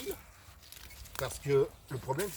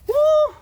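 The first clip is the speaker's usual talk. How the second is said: very high, loud whoop then hoots; string of falsetto victory hoots; triumphant whooping; 18 dB louder than usual. Acted. Hunter celebrating the bear kill, whooping.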